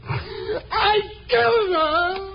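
slow, high, crying wail; drawn-out sobbing cry. Acted. Haunted man sobs his confession to the reporter. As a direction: let the guilt tear out of you slowly.

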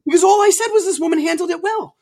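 high, strained yell of self-defence; worked-up screaming. Retold or imitated. Guest admitting he is screaming now as he retells the fight, worked-up yelling. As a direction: shout it high, reliving the argument. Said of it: "Now you're screaming".

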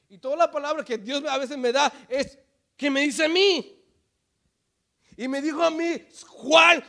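high, very loud shouted sermon; preaching at full force. Acted. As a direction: thunder God's rebuke at the congregation.

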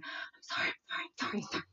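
breathless hushed pleading sorry; whispered apology. Retold or imitated. Daughter begging her sister quietly, hushed pleading. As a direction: whisper apologies fast and anxious. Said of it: "I hear Brooklyn going".

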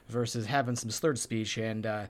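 "blend all together" run loosely into one; lazy slurred speech. Shown as a demonstration. Showing the other extreme to listeners, lazily slurred together. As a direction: let "round edges" slur lazily, consonants softened. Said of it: "They all just kinda lazily".

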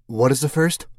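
put-on young woman voice. Mercedes voiced by narrator.

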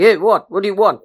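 lightly voiced mother calling down; a hint of a busy mother's demand; mama bear. Mother looking down at baby, lightly voiced questioning. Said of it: "mother is looking down and saying".

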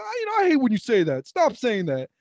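mock annoyed objection, starting high-pitched; Disco. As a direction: imitate someone interrupting to protest. Repeats imitation of co-host objecting.

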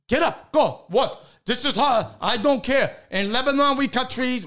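mock an impatient immigrant dad; imitated bossy father. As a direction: push out broken-English orders, brusquely. Retelling gruff immigrant father's orders.

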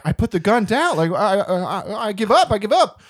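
playful frightened protest; mock panicked surrender. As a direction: plead for your life, half laughing. Acts frightened man surrendering to robot.

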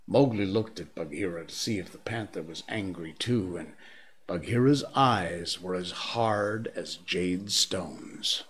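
ordinary delivery, nothing marked

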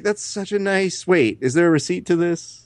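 high-pitched feigned delight that turns questioning; hammed-up thanks for an unwanted gift; mock polite gratitude. Husband to wife about her birthday surprise, mock gratitude.

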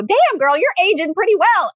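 high-pitched, hyped-up compliment to yourself; admiring self-praise. Retold or imitated. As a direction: pitch jumps up, playfully acting out admiration. Acting out praise to the mirror.

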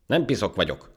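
high, loud, indignant retort; offended. Acted. Ant correcting the monkey's insult, offended. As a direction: object with wounded pride. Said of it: "mondta sértődötten a hangya".

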